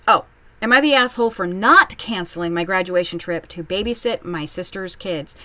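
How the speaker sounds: normal talking voice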